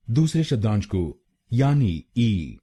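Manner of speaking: ordinary talk, nothing marked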